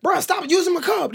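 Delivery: high-pitched exasperated demand; acted-out irritated scolding; mock annoyed complaint. Acted quarrel over a cup.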